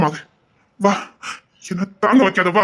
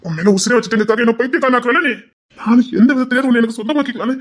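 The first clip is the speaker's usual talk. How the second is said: ordinary talk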